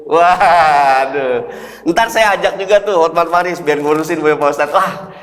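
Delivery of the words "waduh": surprised waduh. Acted. Hearing the teacher of Hotman Paris.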